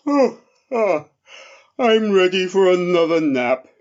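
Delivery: a big drowsy bear just woken, yawning through it; sleepy friendly bear. Acted.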